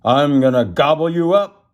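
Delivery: hammed-up storybook threat; troll declaring. A narrator's reading. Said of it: "declared the troll".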